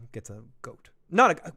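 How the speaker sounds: usual speaking voice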